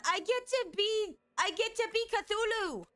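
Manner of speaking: high excited squeal; thrilled squeal. Real, not acted. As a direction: squeal it with glee. Thrilled at becoming Cthulhu.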